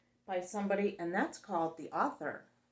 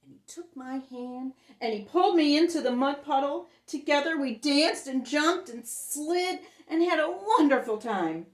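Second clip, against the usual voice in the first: excited narration; 6 semitones higher, 10 dB louder than usual. A narrator's reading.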